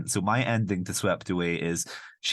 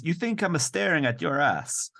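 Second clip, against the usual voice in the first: higher-pitched mock Italian accent; 7 semitones higher than usual. Man confronting woman on the beach, mock Italian accent. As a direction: challenge her in a hammed-up Italian accent. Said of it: "like, he says".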